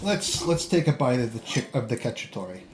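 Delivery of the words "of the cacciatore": playful mock Italian accent on cacciatore. Food vlogger naming the Georgian dish to viewers, light mock Italian accent. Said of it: "saying it with an Italian accent".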